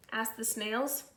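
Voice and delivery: put-on lively read-aloud to kids; storybook reading. A narrator's reading. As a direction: read it like a picture book to the class. Held story narration for children.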